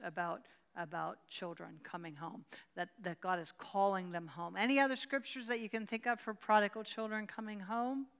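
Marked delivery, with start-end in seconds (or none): none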